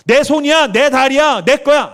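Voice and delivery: loud, high-pitched insistence; defiant possessive claim. Acted. Preacher mimics someone claiming their own body, defiant. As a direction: stake your claim forcefully, high and loud.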